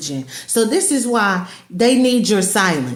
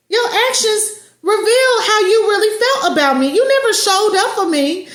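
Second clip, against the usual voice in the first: higher, firm telling-off; confronting the ex; 8 semitones higher than usual. Acted. Victim telling the lying ex off, higher and firm. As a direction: call them out, voice raised high. Talking back to the narcissist.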